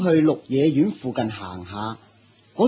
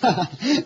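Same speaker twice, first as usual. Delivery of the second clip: sheepish laugh; 7 semitones higher than usual. Acted. Realising the dogs are deer.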